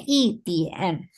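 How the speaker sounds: "itian" drawn out, ending on N; southern, no R. Shown as a demonstration. Teacher showing how southerners say it, "itian" without R. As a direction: say "itian" with no curled R at all. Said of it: "orang selatan ngomongnya nggak pakai R".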